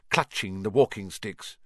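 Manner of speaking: plain reading voice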